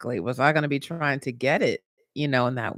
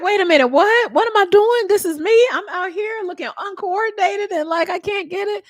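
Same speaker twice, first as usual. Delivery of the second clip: high, louder bewildered disbelief; 5 dB louder than usual. Retold or imitated. Retelling her clumsy first game, high bewildered wail. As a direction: wail it like you can't believe it's you. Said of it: "I'm just like".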